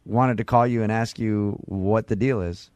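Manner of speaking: ordinary talk, nothing marked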